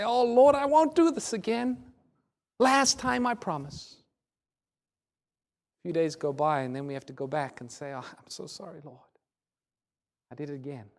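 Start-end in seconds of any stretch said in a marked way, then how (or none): 0.0-4.0 high, loud mock plea to God; insistent, hammed-up begging; pleading promise. Imitates people pleading promises to Jesus. 7.9-10.9 very soft, small-voiced confession; hushed, contrite admission; sheepish apology. Imitates sheepish repeat apology to God.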